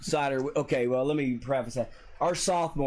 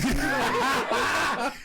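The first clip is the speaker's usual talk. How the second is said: high burst of laughter; 11 semitones higher than usual. Real, not acted. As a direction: crack up laughing. Laughing while retelling the beating.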